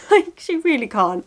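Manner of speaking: ordinary delivery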